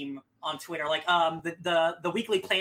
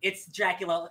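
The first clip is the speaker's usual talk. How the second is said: ordinary delivery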